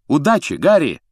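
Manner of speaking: high, bright cheer of good luck; lifted, encouraging shout; called out. A narrator's reading. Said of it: "крикнула Джоу Чанг".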